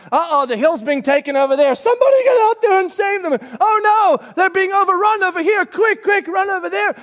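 high, loud frantic commander; battle commander. Retold or imitated. Commander on the hill to troops: frantic yelling. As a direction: yell orders as the hill falls. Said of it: "like a commander on the hill going".

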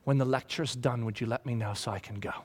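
mock an impatient kid; bored teenager. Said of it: "mouth clenched".

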